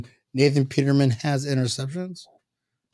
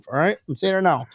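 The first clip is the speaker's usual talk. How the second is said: ordinary talk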